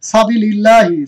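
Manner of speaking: সাবিলিল্লাহি with a thin light লাম; recited model word. Shown as a demonstration. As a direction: recite it slowly. (পাতলা লামে পাঠ)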